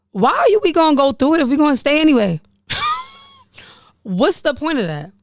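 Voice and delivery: high-pitched, incredulous asking; real high-voiced exasperation; exasperated questioning. Real, not acted. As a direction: push it up high in amused disbelief. Exasperated rhetorical question, laughing.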